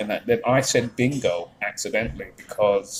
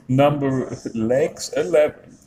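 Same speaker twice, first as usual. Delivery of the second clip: bingo caller; 4 semitones higher, slower than usual. Retold or imitated. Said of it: "they do this".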